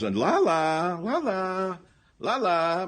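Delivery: high sing-song la la mockery; mocking mayor voice. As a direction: sneer it out as a prissy mayor. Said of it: "The mayor of Portland says".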